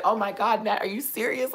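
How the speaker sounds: high, incredulous gasping-friend imitation; shocked friend. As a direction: mimic a friend's high-pitched disbelief. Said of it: "He's like".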